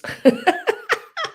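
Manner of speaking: squealing laugh at her own slip; a high giggling burst; high burst of laughter. Real, not acted. Seller cracking up at her typo, high laugh.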